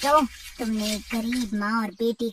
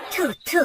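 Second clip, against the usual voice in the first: spitting in disgust; 6 semitones higher than usual. Acted. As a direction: spit out the dung in disgust. Dung splashes on crow's face. (घिन से थूकना)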